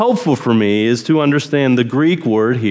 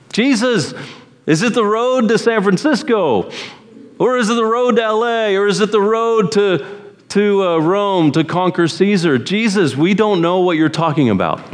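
higher, lightly voiced puzzled disciple; a hint of bewildered questioning, pitched up; confused Thomas; 6 semitones higher than usual. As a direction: play a baffled Thomas asking directions. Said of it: "Thomas is confused".

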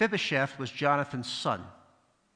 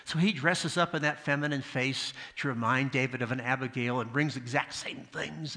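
ordinary delivery

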